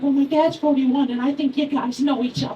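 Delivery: put-on high, matter-of-fact kid voice; Tommy. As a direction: imitate a first-grader explaining. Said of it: "He's like".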